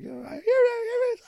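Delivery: very high squealing mimicry; excited girlfriend. Girlfriend catching his lisp, high mock excited squeal. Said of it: "She's just like".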